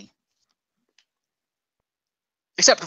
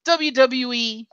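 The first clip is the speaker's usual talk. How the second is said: ordinary talk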